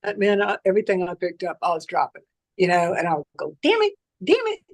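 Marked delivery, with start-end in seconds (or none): 3.6-4.6 re-enacted high-pitched exasperated cursing; frustrated outburst. Patient telling how they kept dropping things, frustrated high-pitched outburst. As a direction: curse at yourself in high frustration. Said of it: "I still get frustrated".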